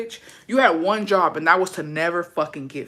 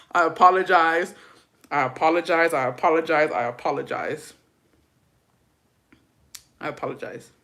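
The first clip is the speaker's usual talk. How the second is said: normal talking voice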